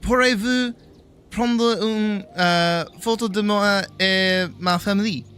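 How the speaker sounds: confident swagger. Retold or imitated. Tourist asking a family for a photo in French, confident swagger. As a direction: ask in French with cocky confidence. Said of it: "like, swagger and, like, confidence".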